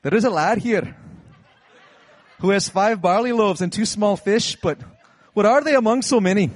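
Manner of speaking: put-on loud, high Scottish accent; hammed-up Scottish battle-speech voice; William Wallace in Scottish accent. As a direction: declaim it like a rousing Scottish warrior. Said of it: "in a Scottish accent".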